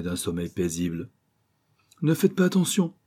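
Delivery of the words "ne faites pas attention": quick, higher-pitched dismissal; lively professor brushing it off; brisk reply. A narrator's reading. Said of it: "dit vivement le professeur".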